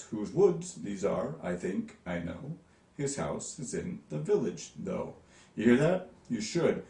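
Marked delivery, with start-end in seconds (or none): 0.0-5.1 "Whose woods these are" in deliberate da-DUMs, lifting each stressed beat; exaggerated iambic stress. Shown as a demonstration. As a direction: overdo every second syllable, pausing between "I think" and "I know". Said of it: "not necessarily gonna state it quite that, uh, profoundly".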